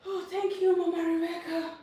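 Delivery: high, loud, delighted thank you; excited thanks. Real, not acted. Thanking viewer Mama Rebecca for support, excited. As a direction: beam it out to the viewer.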